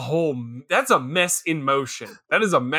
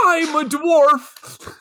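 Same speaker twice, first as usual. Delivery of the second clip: slow, high, loud mock yell; mock rage; 10 semitones higher, 5 dB louder, much slower than usual. Friend imitating the actor's line, mock rage. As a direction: stretch it out with fake fury. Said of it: "there's rage in his voice as he does it".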